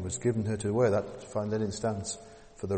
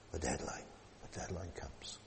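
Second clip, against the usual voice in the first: whispered; 6 semitones lower, 15 dB quieter than usual. Acted. Preacher telling students the deadline has come, whispered. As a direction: let it land in a whisper. Deadline arrives, dramatic hush.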